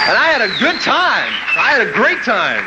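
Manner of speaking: high-pitched, exultant recollection; elated. Retold or imitated. Young DJ recalling his great time on air, elated. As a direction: stretch it out with glee, pitch way up.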